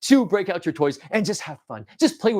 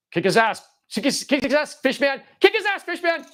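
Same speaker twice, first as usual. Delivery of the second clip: high-pitched egging-on, climbing higher; playful cheering; 8 semitones higher than usual. Pretend. Toy player urging fish man to fight, excited cheer.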